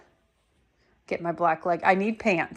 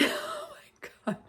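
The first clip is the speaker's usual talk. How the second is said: laughing; 6 dB quieter than usual. Real, not acted. Laughing at her own outfit.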